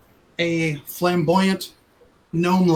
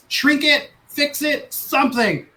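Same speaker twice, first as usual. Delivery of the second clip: 1 panicked orders; 7 semitones higher than usual. Acted.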